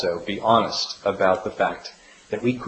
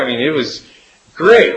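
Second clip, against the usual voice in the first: ordinary talk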